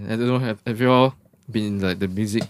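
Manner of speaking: usual speaking voice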